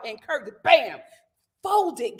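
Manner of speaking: ordinary talk, nothing marked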